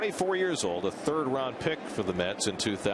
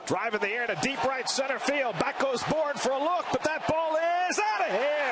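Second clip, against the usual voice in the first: excited rising home run call, shouting; 13 semitones higher than usual. Real, not acted. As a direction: call the homer at full cry.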